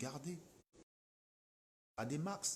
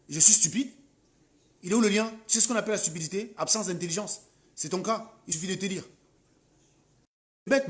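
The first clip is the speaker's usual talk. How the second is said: loud, high, furious scolding of a commenter; real anger at a viewer; angry rant; 4 semitones higher, 5 dB louder than usual. Real, not acted. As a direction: tear into her, voice raised. Ranting at a hostile commenter.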